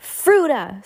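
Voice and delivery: put-on mild baby shriek; a light shriek. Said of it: "Sonny shrieked".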